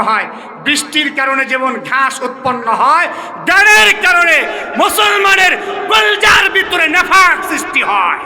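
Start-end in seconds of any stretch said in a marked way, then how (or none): 3.5-8.2 raised, forceful sermon lines; preaching loudly. Acted. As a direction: drive the point home to the crowd. (জোরালো ওয়াজ)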